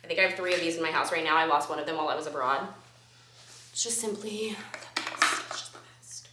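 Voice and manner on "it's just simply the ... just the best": breathy whisper, almost voiceless; hushed reverent whisper. Acted. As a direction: whisper it like a secret. Hushed gushing over lip gloss.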